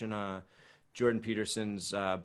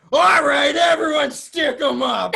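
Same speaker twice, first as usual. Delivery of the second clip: loud, high cop bark; cop shout; 15 dB louder, slower than usual. Retold or imitated. Improv partner playing a cop on stage, shouting the order. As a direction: yell it like a cop busting in. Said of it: "you just turned into this cop".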